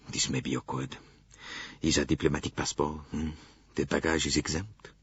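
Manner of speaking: low, soft fretful Frenchman; lightly voiced anxious Bouc; Monsieur Bouc. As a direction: mutter it like a worried railway director. Bouc voice held.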